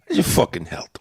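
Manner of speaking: low Italian-American greeting, "health" as "helt"; Italian-American street talk with a hard final T; Italian-American accent. Shown as a demonstration. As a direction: ask it like an Italian-American wiseguy. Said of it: "They don't pronounce the H".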